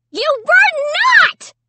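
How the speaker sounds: very high, loud shriek of denial; scream. A narrator's reading. Sister screaming at brother over her painting. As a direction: shriek it in outrage. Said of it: "Sarah screamed".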